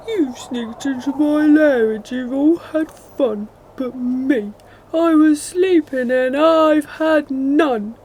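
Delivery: loud, high whiny bear complaint; grumpy bear. A narrator's reading. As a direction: wail it like a cranky cub.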